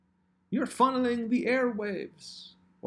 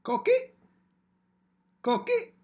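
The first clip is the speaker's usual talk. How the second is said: high chirping frog call; coqui frog call; 4 dB louder than usual. Acted. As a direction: chirp it like a tiny tree frog. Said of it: "my warm-up phrase for my mic check".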